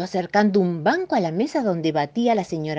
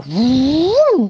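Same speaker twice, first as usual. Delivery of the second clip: loud, high "vum" whoosh as the monster rushes in; whoosh sound effect; 8 semitones higher, 9 dB louder than usual. A narrator's reading. Narrator marking the monster's entrance with a loud whoosh.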